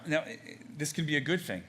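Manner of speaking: usual speaking voice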